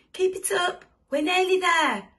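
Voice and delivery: high, loud rallying shout; Mister Gumpy crying out. A narrator's reading. Driver to his passengers pushing uphill, shouting encouragement. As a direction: urge them on at full voice. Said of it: "cried Mister Gumpy".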